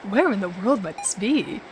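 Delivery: low, quiet character voice; a softly spoken low voice, testing it out; Mishima. Acted. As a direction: try out a low, soft male lead. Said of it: "I gotta remember his voice".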